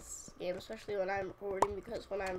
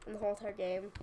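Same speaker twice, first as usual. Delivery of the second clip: ordinary delivery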